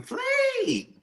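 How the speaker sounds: drawn-out, very high-pitched mock scream; retold granny scream. Retelling great granny's warning to the kids, high mock scream. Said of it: "she used to scream".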